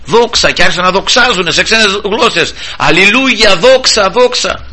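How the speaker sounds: high, rising cries of glory; joyful praise. Retold or imitated. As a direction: lift it up like the apostles praising. Apostles glorifying in tongues. (χαρούμενη, ψηλή δοξολογία)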